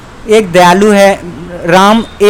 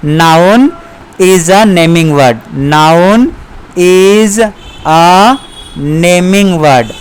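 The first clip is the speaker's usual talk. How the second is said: each word spaced out slowly; slow teacher dictation; much slower than usual. Shown as a demonstration. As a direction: dictate it word by word for students to write. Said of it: "आप इसका बोलेंगे".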